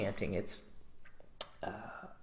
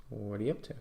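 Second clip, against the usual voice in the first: "What are you up to" softer, rising at the end; uptone; 8 dB quieter than usual. Shown as a demonstration. Coach demonstrating an opener for women, uptone ending. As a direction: lift your pitch on the final "to". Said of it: "Uptone, intonation".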